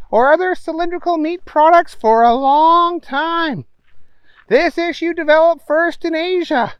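high bright newscaster voice; news reader. A narrator's reading. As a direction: read it like a TV anchor. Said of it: "the newswoman came back on".

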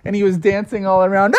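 usual speaking voice

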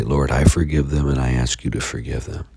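ordinary delivery

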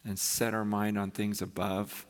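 low, soft, unhurried prayer voice; quiet prayer. Acted. Pastor praying softly over the congregation before preaching, hushed prayer. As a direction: pray it low and hushed, pauses between phrases.

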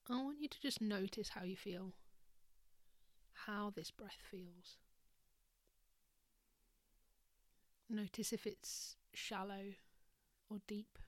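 soft whispered meditation voice; guided meditation. Acted. Leads a breathing meditation.

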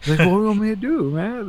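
high, loud, rushed defensiveness; retold exasperated protest. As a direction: throw it back fast and high, helplessly. Buddy defends himself in retold exchange.

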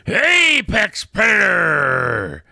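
slow roaring battle cry; catchphrase shout. Acted. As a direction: bellow it slowly. Don's catchphrase again.

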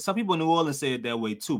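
usual speaking voice

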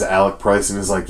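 ordinary delivery

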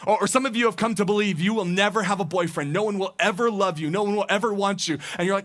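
ordinary talk

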